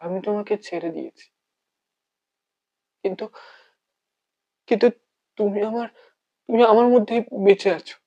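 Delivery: hushed, halting monologue with long pauses; heartbroken lover. Acted. Bereft lover speaking to the one who is gone, heartbroken.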